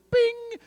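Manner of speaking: high-pitched ping; emoji bing. Acted. Showing a mind-blown emoji, high bing. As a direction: ring it out high like a little bell.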